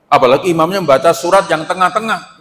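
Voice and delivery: normal talking voice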